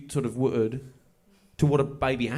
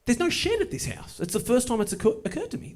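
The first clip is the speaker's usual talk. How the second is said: sudden much higher surprised pitch; realisation; 9 semitones higher than usual. Real, not acted. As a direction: squeak as it dawns on you.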